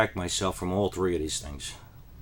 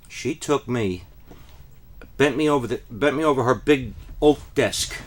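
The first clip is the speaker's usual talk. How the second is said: usual speaking voice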